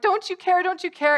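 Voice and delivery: high, loud, insistent questioning; panicked plea. Acted. Disciples to Jesus in the storm, panicked plea.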